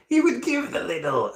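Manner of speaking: high, theatrical villain voice; Joker. Retold or imitated. Streamer showing how the actor voiced Joker, high villain voice. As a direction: slip into a high cartoon-villain voice. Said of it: "used to voice the Joker".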